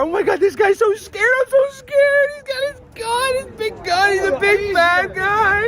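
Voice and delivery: mock terror. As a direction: pretend to quake at his toy gun.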